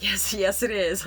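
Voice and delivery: breaking into laughter while answering the neighbor; laughing yes. Retold or imitated. Telling the kind neighbor yes with a laugh. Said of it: "I laughed".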